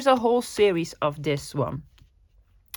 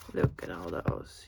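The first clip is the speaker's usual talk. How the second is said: much lower, breathy awed whisper; awed whisper over the pictures; 9 dB quieter than usual. Real, not acted. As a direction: hush it in wonder. Marvelling at butterfly pictures.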